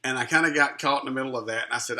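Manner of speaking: ordinary talk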